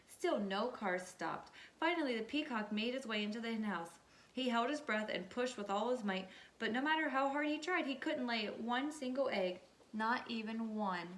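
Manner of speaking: ordinary delivery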